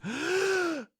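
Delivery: high, quick gasp in; big fast gasp. Shown as a demonstration. Teacher shows the in-breath of the laughter pattern, big gasp. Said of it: "big, fast in breath".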